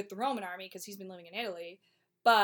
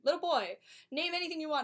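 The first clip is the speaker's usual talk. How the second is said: higher, generous royal offer; magnanimous king; 8 semitones higher, 4 dB louder, faster than usual. Retold or imitated. King offering the little boy any wish. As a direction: grandly offer the boy a reward.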